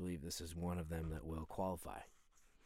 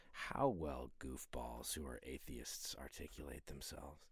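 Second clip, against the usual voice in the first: whisper; 7 semitones lower, 5 dB quieter, slower than usual. Real, not acted. Dismissing atheists in an aside.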